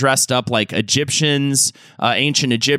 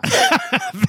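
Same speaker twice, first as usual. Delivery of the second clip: short high-pitched laugh; real amused laugh. Real, not acted. Host laughs at bit.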